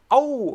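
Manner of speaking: "Oh" sung out high and loud with a wide open mouth; wrong open Oh. Shown as a demonstration. Teacher showing the wrong vowel, a high loud "Oh". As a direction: pop the "Oh" up high and round. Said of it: "but I'm also not saying".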